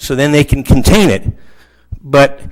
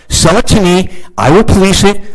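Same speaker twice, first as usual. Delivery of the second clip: ordinary talk, nothing marked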